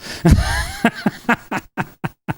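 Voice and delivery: high laugh in quick bursts; real rattling chuckle, pitched high; amused laugh. Real, not acted. Reacting to the YouTube video, high amused laugh.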